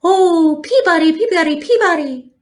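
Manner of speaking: high, ringing birdsong call; sparrow call. A narrator's reading. Sparrow calling from the woods, high birdsong.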